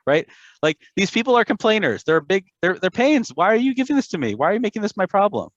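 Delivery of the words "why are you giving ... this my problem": fast, high, fed-up complaint; exasperated whine. Retold or imitated. Moshe asks God why this burden is his, fast and exasperated. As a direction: rattle it off like a man at the end of his rope.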